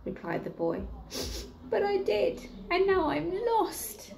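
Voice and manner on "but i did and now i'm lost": mock woeful boy. Lost boy telling the bear, high and mock woeful. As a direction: drag out the sad confession.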